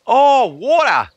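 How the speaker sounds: put-on high, loud Australian accent, drawn out; Australian waitress. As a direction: mimic an Australian waitress finally understanding, high and slow. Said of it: "when she finally got it".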